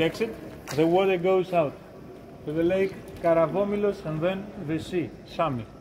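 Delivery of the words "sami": ordinary delivery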